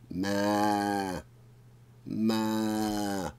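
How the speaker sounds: put-on long drawn baby-ox moo; strange cry. A narrator's reading. Frozen baby ox crying for its mother, drawn-out moo. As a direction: call out like a lost calf. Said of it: "he heard a strange cry".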